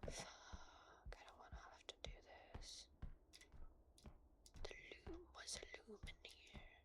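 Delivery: tense whisper while hiding. Real, not acted. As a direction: whisper so nobody hears you.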